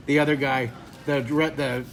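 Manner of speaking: ordinary talk, nothing marked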